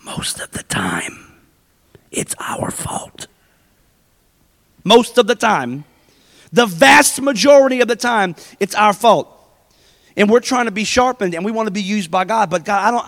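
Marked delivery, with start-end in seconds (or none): 0.0-3.3 slow, hushed whisper; whispered confession to the congregation; secret whisper. Shown as a demonstration. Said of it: "You ready for a secret". 4.8-9.3 louder, emphatic repeat; preacher driving the point home; firm proclamation. Shown as a demonstration. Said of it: "You ready for a secret".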